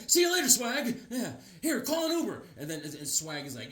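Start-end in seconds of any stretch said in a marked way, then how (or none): 0.1-2.4 high soft hurried goodbye; high soft voice of a fleeing friend. Retold or imitated. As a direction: squeak it out while running off. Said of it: "The Beckerman's like".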